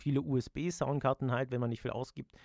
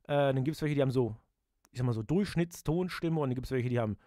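usual speaking voice

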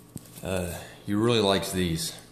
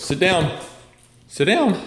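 louder, higher sit command; firm command to dog; 9 semitones higher, 4 dB louder, slower than usual. Real, not acted. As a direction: tell the dog to sit firmly.

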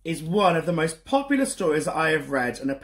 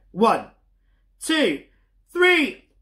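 rising higher and louder up to three; building countdown; 6 semitones higher, 4 dB louder, much slower than usual. Shown as a demonstration. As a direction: count up to a big shout. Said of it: "as loud as you can".

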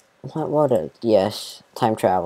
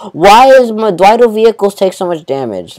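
exasperated; 8 semitones higher, 4 dB louder than usual. Real, not acted. Frustrated outburst.

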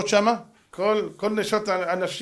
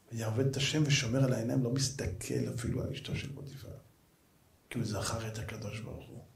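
whisper; 8 semitones lower, 11 dB quieter than usual. Real, not acted. Preacher drops to hushed awe.

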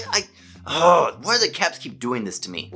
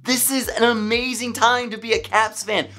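excited; 7 semitones higher than usual. Real, not acted. Fan celebrating the Caps' streak.

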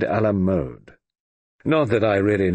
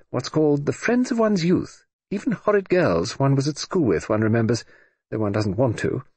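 put-on lightly grand, airy old lady; breezy, haughty dowager dismissal; grand hollywood dame; 6 semitones higher than usual. Lady Matilda teasing the Admiral.

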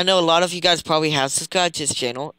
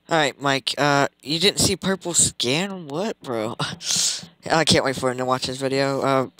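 ordinary talk, nothing marked

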